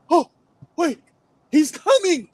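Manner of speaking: high, loud sudden panic; panicked realization. Retold or imitated. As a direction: gasp it out as the fear hits. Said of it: "They're like".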